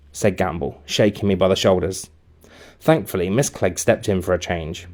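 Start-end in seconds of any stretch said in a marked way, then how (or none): none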